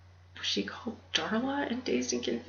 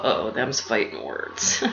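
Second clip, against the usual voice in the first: low, slow mock-tough drawl; mock tough talk; 7 semitones lower, slower than usual. Mocking the ad sites with a slow mock-tough line. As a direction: growl it like an old western dare. Mock challenge to ad-heavy site.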